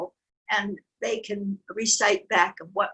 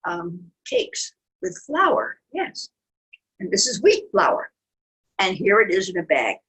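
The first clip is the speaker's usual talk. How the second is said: lively storybook reading; animated picture-book narration; reading to children. A narrator's reading. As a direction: tell it to little ones.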